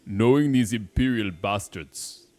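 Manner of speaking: slow, heavy put-on accent; accented game character. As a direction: play a game character slowly with a muddled accent.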